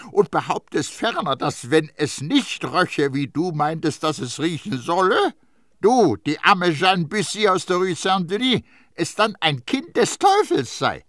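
high, loud, pressing accusation; indignant, emphatic cross-examining; sharp interrogation. A narrator's reading. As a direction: drive the charge home like a prosecutor. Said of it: "und fragte scharf".

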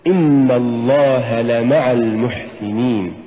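slow reading, "لمع" as la-ma'a with separate la; correct لمع. Shown as a demonstration. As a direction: say إن الله لمع المحسنين slowly, each vowel of لمع full. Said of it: "قل".

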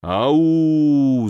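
long drawn-out ghostly howl; sepulchral howl. A narrator's reading. Lackey scaring the nurse in the dark, sepulchral howl. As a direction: howl like a spook from the grave. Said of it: "завыл лакей загробным голосом".

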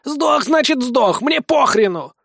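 high, dismissive creature voice; irritated creature. Acted. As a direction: brush it off in a high voice. Creature snaps at squeaking body. (раздражённый высокий голос)

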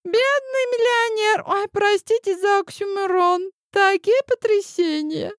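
high and giddy with leftover laughter; still laughing. Acted. Vangelia mocking the millionaire, high and giggly. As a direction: keep cracking up as you speak.

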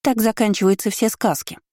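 put-on high confident child voice; child stating the obvious; little girl voice. A narrator's reading. Girl speaks. (уверенный детский голос)

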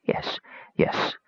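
breathy unvoiced whisper. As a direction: whisper it close. Whispered reply in a story.

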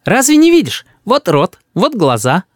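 put-on high child voice, pointing things out; insistent little boy. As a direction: prove it like an indignant kid. Boy proves moon is real.

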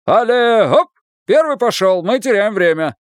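bossy coach giving orders; authoritative command. A narrator's reading. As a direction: bark the order with full authority. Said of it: "придав голосу всю возможную властность".

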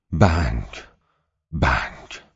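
plain reading voice

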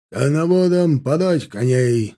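loud, slow, stretched order; military command. A narrator's reading. Lieutenant colonel calling for the horses, commanding. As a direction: call it out to carry. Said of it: "скомандовал подполковник".

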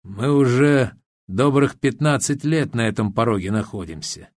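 plain reading voice

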